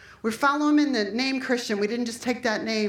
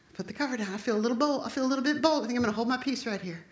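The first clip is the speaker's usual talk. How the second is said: ordinary delivery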